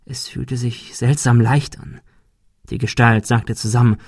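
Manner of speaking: ordinary delivery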